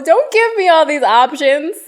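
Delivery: normal talking voice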